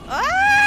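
high, loud yelp; startled scream. Real, not acted. Streamer startled in the dark, sharp scream. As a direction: shriek at the jump scare. Sudden scare.

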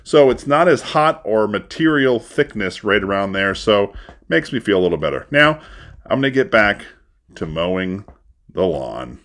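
muffled by cloth over mouth; talking through a neck gaiter; through a face covering. Real, not acted. Seen 0: Gaiter covers mouth and nose throughout; R frames show mouth uncovered.

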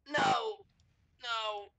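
high, drawn-out wail of no; dismayed cry. Real, not acted. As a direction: cry out in dismay as it all crashes, then fade. Reacting to a crash in game.

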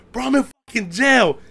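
high, very loud phone plea; retold frantic shouting. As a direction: shout the bad news to your brother. Retelling his frantic jail phone call.

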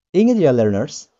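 ordinary talk, nothing marked

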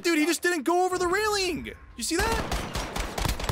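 very high stunned disbelief; disbelieving. Real, not acted. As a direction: squeal in disbelief. Stunned by an enemy's move.

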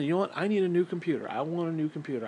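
ordinary talk, nothing marked

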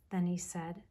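usual speaking voice

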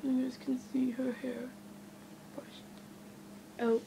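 holding back hiccups; talking through hiccups. Real, not acted. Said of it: "Sorry, I have hiccups".

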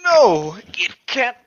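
loud, high, stretched-out shout of disbelief. Acted. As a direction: yell it out slowly, horrified. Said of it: "Bruce shouted the words".

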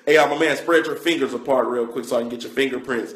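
low, soft put-on voice; mock official request. Comic plays someone taking fingerprints off his head, low mock-official. Acts someone using the handprint scanner.

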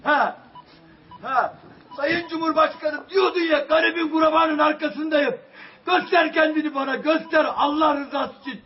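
high, loud begging cry to the President; real anguish, calling for help; desperate shouting plea. Real, not acted. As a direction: yell the plea with everything you have.

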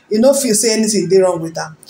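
usual speaking voice

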